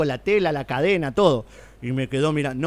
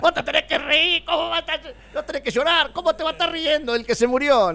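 high-pitched mock telling-off; imitating scolding father; 11 semitones higher than usual. Father scolding his laughing clown son at the mock funeral, hammed-up indignation. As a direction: act out an indignant dad, pitched way up. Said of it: "mi papá me agarró a chalupazo".

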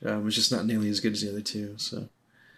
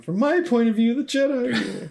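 dramatic movie quote; 13 semitones higher than usual. Retold or imitated. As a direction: hurl it like a furious young Jedi. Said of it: "my favorite line of any movie".

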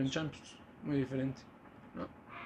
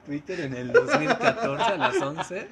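laughing; 4 semitones higher, 4 dB louder than usual. Real, not acted. Teasing, slow with laughter.

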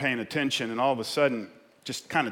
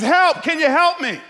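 high, loud mock cry for help; yelled plea; 12 dB louder than usual. Man on the branch begs heaven for help, yelling. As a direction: plead at a shout. Acted man hanging off cliff.

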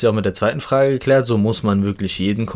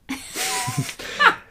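breathy giggle; 4 dB quieter than usual. Real, not acted. Laughs before mock announcement.

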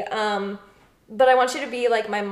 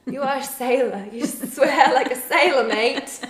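put-on British accent; 2 semitones higher, 4 dB louder than usual. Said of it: "we're terrible at accents".

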